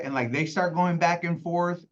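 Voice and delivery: ordinary delivery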